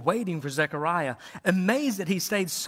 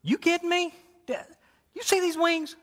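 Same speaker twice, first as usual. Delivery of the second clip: indignant angel; 11 semitones higher than usual. Acted.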